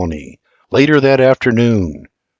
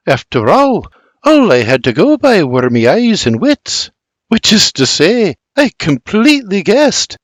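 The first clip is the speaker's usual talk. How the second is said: much higher, slowed 'Tis perfect, chirpy Scots; cheerful mother, higher and louder; delighted mother; 9 semitones higher, 3 dB louder than usual. Acted. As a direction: lighten the mood with cheer. Berta lightens the moment.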